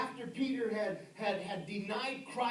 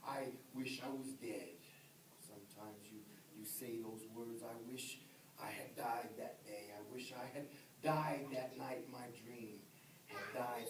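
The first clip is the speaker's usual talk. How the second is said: despairing murmur; 8 semitones lower, 9 dB quieter than usual. Retold or imitated. Voicing a despairing person.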